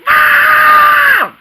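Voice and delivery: long high yell for mom; calling out loud. Acted. Scared boy yells for his mom in the night. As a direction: shout for mom across the house.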